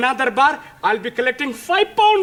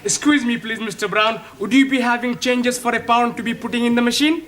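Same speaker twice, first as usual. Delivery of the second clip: put-on polite Indian-English accent, eager to win; courteous Indian accent, sure of his luck.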